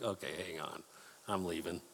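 quiet, low mock getaway; understated aside. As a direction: drop your voice as if slipping quietly away. Voices Jesus slipping away from crowd.

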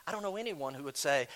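ordinary delivery

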